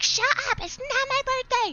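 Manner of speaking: high, loud mock shriek; imitated screaming. Girl fed up with endless birthday greetings, mock screaming. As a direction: exaggerate an exasperated girl's scream. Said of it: "She was screaming at me".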